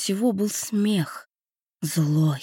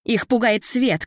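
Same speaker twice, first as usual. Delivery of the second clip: shouting; 4 semitones higher, slower than usual. A narrator's reading. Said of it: "крикнула Лютая".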